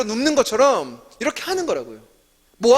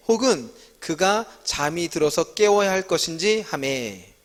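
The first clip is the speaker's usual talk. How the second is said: measured, slightly slower Bible reading; scripture reading; 4 semitones lower, 3 dB quieter than usual. Acted. Teacher reading 1 Kings aloud to the class, steady scripture reading. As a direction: read the passage out evenly, like a responsive reading.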